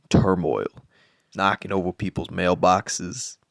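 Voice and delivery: ordinary talk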